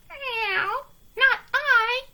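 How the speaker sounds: a prissy cat saying no. A narrator's reading. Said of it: "said the cat".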